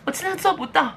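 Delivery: put-on high, whimpering parody of a crying girl; mock crying whine. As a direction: whine it like a mocked crybaby. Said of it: "你还在那边一直哭说".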